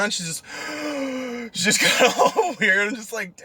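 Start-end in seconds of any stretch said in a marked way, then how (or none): 0.5-1.5 soft high mock gasp; sharp intake of breath, imitating shock; imitated gasp. Driver mimics passenger taken aback by his retort, mock gasp.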